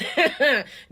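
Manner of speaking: a short real laugh at the irony; burst of laughter. Real, not acted. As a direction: laugh it off, high and loud.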